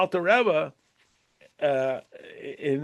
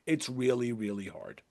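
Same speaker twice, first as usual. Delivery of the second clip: much softer, fading into a hush; gently concede, almost under the breath; soft sympathy; 7 semitones lower, 10 dB quieter than usual. Shown as a demonstration. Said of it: "I'm telling you, I agree".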